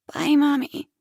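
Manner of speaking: put-on a little girl's sad goodbye; small child goodbye. Daughter leaving mother, high child voice.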